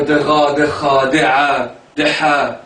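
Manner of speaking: slow ra, kha, a, ha from deep in the throat; throat letters. Shown as a demonstration. Teacher showing the hard throat letters, slow and guttural. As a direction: drag each throat letter out slowly. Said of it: "voilà, vous en avez des".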